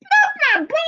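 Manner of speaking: very high, delighted call; thrilled high-pitched cry; excited greeting. Real, not acted. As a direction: squeal it proudly at seeing your friend. Spots Porter arriving, calls out.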